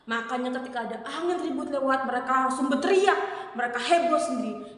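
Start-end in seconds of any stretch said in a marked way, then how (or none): none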